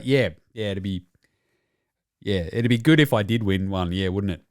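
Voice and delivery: low, awkward, deflated imitation; mock downcast. Imitated player replying awkwardly about never winning a title, downcast. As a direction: answer low, with halting reluctance. Acts embarrassed Horford on stage.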